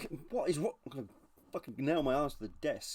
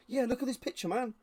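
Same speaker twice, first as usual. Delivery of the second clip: higher, mock-eager insistence; eager reenactor; 10 semitones higher than usual. One reenactor shows another the picture, mock eager. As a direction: play a keen hobbyist proving a point. Acted reenactor showing off the photo.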